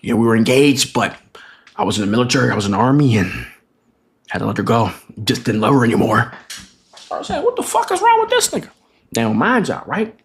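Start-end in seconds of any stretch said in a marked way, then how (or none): 0.1-6.3 low, downcast imitation; sad-story voice. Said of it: "sad-ass story". 7.4-8.7 high-pitched, incredulous reaction; baffled disbelief. Retold or imitated. Acted bewildered reaction to sad story.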